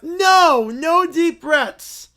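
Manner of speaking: loud, very slow refusal; whiny kid. Acted. Kid refusing calm-down breathing from parents, stubborn whine. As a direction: drag out the no like a tantrum. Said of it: "the Whiny Quintet".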